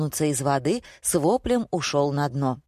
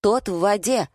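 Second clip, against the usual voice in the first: higher-pitched, insistent plea; pitched-up urging; exasperated appeal; 6 semitones higher than usual. A narrator's reading. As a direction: call them to their senses. Pleading with crazed customers to wake up.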